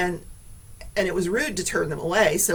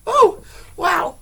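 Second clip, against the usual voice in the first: shocked; 12 semitones higher, 9 dB louder than usual. Real, not acted. After spider scare.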